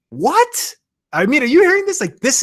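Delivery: can you believe this, high and loud; real amazement sharing big news; amazed disbelief. Real, not acted. Stunned by brand deal income.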